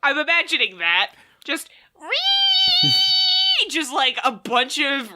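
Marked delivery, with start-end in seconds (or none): 2.0-3.7 high piercing frog shriek; screaming frog noise. Retold or imitated. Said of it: "thirty screaming frogs".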